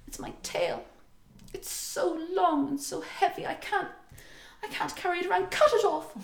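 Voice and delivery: a cat wife begging him to cut off her tail, hammed up a little; pleading cat princess. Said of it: "she begs and she pleads".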